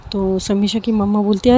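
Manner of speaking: ordinary delivery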